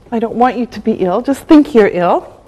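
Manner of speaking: ordinary delivery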